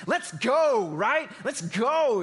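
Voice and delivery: fired-up shout. Real, not acted. Pastor rallying the congregation: loud excited shout. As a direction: pump the room up with it.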